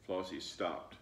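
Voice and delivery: plain reading voice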